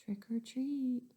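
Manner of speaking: slow whispered trick or treat; boy asking very softly. Acted. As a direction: breathe it out slowly. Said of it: "so he said very softly".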